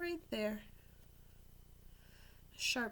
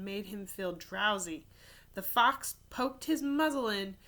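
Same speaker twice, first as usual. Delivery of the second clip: put-on lively storybook narration for kids; animated read-aloud; reading to children; 9 dB louder than usual. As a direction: tell it like a picture book at story time. Reads picture book aloud.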